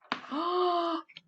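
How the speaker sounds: big high mock gasp of surprise; theatrical gasp. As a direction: gasp as if shocked for the kids. Surprise reveal in story.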